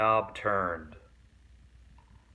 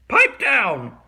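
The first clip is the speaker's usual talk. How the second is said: loud command; 10 semitones higher, 10 dB louder than usual. A narrator's reading. Swamp silences the class.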